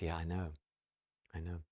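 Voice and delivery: soft, downcast agreement; hushed, resigned acknowledgement; subdued admission. Retold or imitated. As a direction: concede it very quietly. Mom concedes again.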